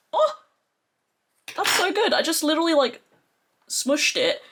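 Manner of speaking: high, bright exclaim of real delight. Real, not acted. Vlogger delighted the shade popped out, excited high exclaim. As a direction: squeal over a small win.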